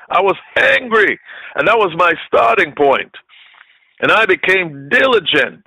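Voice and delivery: high, driving preacher voice; fiery preaching. Acted. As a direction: preach it hot and building. Preaching at full force.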